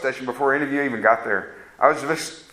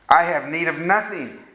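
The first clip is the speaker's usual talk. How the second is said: ordinary delivery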